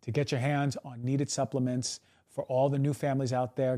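ordinary talk

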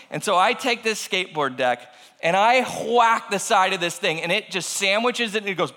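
usual speaking voice

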